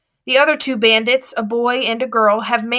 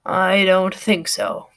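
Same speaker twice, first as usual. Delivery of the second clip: low, slow, husky voice; a disguised man drops the act; husky baritone; 3 semitones lower, slower than usual. A narrator's reading. Passenger reveals himself, low and husky. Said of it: "husky and very unladylike baritone".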